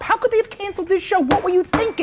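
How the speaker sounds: high indignant squeal; mock outrage. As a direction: shriek it like an outraged promoter.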